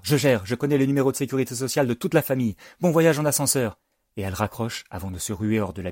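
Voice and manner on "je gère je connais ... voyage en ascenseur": high, fast, eager rattle; breezy child hurrying to reassure; jumping in. A narrator's reading. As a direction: take charge in a rush, pitch raised. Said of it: "bondit Ambre".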